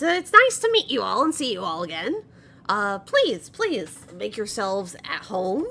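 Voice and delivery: loud, eager goblin host; welcoming goblin host. Acted. Goblin host welcomes the party into the home, eager and loud. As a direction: usher guests in with lively swoops of pitch.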